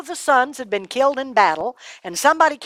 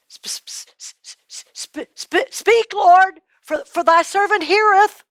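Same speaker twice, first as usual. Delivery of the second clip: nervous stammer; 10 semitones higher, slower than usual. A narrator's reading. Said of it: "Samuel stammers his answer".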